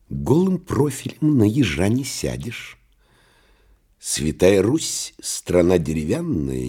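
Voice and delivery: sneering high put-on voice; mocking nightmare. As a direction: taunt him like a jeering imp. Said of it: "глумливо сказал". (глумливый голос кошмара)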